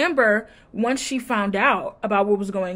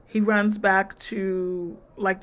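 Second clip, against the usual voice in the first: normal talking voice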